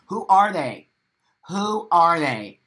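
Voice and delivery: loud, insistent challenge; indignant demand. Real, not acted. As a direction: demand an answer, loud and drawn out. Ranting demand to name gatekeepers.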